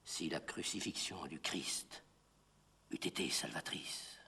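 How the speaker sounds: very soft, low aside; hushed recitation. Acted. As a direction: drop right down, almost to breath. Hushed doubt within recited poem. (tout bas, presque murmuré)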